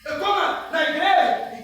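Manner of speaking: high, loud mock boast of being in church; hammed-up boast, high and loud; mock proud shout. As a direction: shout it like a smug new believer.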